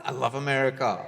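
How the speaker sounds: ordinary talk, nothing marked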